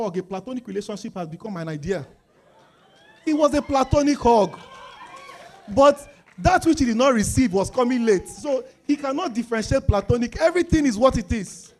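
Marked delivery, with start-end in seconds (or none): 3.2-11.7 loud, raised preaching voice; preacher rising into loud exhortation; full-force preaching. Real, not acted. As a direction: drive it home to the congregation.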